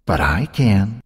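plain reading voice

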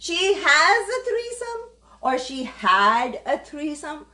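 "has a threesome" said slowly and clearly as the right form; slow correction. Shown as a demonstration. She corrects a viewer's English for the stream, slowly. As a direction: spell out "has" and "had" slowly like a teacher. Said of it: "She is a threesome is कोई sentence नहीं होता".

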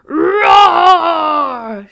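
huge high loud roar; lion roar. Acted. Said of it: "shouted Lion".

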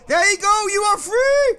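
high, loud cheer of release; triumphant call. Real, not acted. Player cheers a rescued character free, loud and triumphant. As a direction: call out freeing the prisoner. Freeing a trapped game character.

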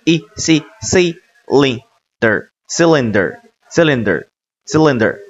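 "cylinder" built slowly, syllable by syllable; slow blending demo. Shown as a demonstration. Teacher joining three syllables for learners, slow blending demo. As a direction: spell out "C", "lin", "的儿", then say "cylinder" whole. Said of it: "读的是一，C".

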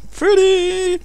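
very high, sweet falsetto; mock girly voice; sugary little girl. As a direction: mimic a sickly-sweet girly squeak. Mimics the sugary girl from the clip.

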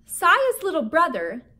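ordinary delivery, nothing marked

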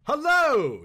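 high, loud cheery greeting; a jolly earl booming hello; hearty hello. A narrator's reading. Earl greeting his visitor loudly and cheerily. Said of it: "said this pleasant fellow".

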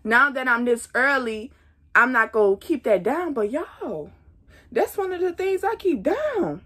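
usual speaking voice

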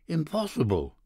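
a high, lightly acted burst of disbelief; shocked cry. A narrator's reading. Narrator hearing his friend's father is dying, crying out in shock. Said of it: "I cried".

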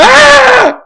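high, loud shriek; startled scream. Real, not acted. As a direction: scream at a sudden threat. Creeper scare.

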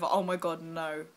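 usual speaking voice

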